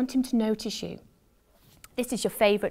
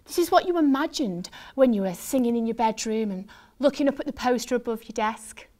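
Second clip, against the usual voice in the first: plain reading voice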